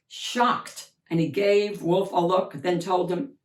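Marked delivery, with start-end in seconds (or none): none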